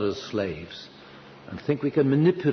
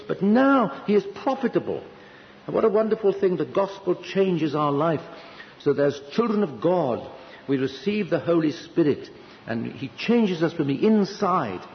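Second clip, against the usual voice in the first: higher, fervent preaching lift; rising fervour; 7 semitones higher than usual. Real, not acted. Preacher exulting to the congregation, higher and fervent. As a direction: lift them up with joy.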